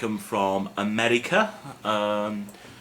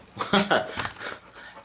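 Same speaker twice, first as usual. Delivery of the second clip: high breathy laugh; 10 semitones higher than usual. Real, not acted. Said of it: "that's why I'm single".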